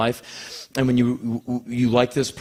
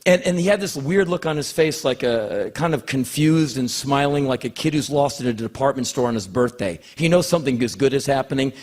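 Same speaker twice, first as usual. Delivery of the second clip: ordinary delivery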